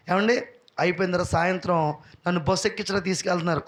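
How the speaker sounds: ordinary delivery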